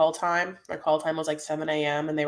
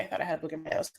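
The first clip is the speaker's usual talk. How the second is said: ordinary delivery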